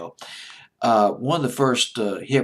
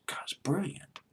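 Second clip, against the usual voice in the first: whisper; 7 semitones higher, 11 dB quieter than usual. Real, not acted. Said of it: "awed aside".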